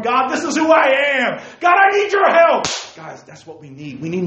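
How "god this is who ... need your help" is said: loud, high desperate cry to God; pleading cry. Acted. Preacher acts out a desperate prayer, loud pleading cry. Acting out pouring heart out.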